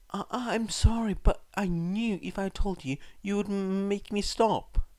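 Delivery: put-on high, stammering boy's apology; shameful apology. A narrator's reading. Son apologizing to his crying mum, ashamed and high. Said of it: "Izuku shamefully apologized".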